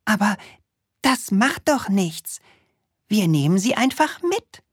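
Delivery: put-on high, eager little boy voice; Krümel voice. Little Krümel inviting the witch to a party, high and eager.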